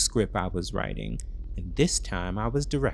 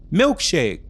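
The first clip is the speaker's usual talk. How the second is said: calling out; 7 semitones higher, 9 dB louder than usual. Acted. Boyfriend calling from bathroom.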